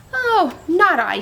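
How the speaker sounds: a loud, slow "oh, not I"; dog's voice. A narrator's reading. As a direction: drawl it out like a lazy dog. Animals refuse the hen.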